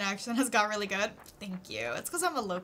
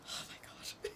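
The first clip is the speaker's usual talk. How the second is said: breathless whispered oh my god; 17 dB quieter than usual. Real, not acted. Streamer whispers at her own naming troubles. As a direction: whisper it in disbelief.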